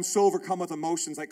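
usual speaking voice

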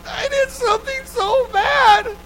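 high, slow, crying shout; a sobbing kid's confession; bawling. Retold or imitated. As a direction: wail it like a child in tears. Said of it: "I'm bawling".

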